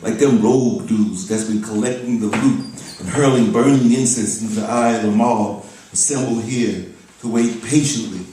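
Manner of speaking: forceful, rhythmic spoken-word recital; poetry reading. Acted. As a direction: build the verse in strength, leaning on the images. Reads second poem aloud.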